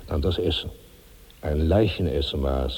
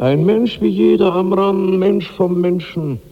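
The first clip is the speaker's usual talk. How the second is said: much higher, louder, ringing cry; ecstatic cry; 13 semitones higher, 7 dB louder than usual. Acted. Karnak cries out his rebirth to Amran, high and loud. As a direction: cry it out, triumphant and strange.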